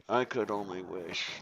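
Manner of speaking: quiet wistful echo. Real, not acted. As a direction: repeat it wistfully under the breath. Repeated wish, softer echo.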